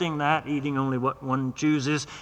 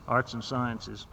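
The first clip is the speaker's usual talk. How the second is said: low and much softer, timid; meek quiet reply; 4 semitones lower, 10 dB quieter, slower than usual. Acted. Patient answering the domineering doctor, meek and quiet. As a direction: answer small and subdued.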